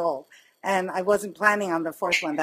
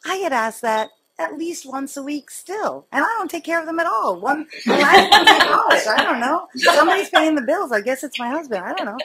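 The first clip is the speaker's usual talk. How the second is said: excited; 7 semitones higher, 7 dB louder than usual. Retold or imitated. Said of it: "And I'm like".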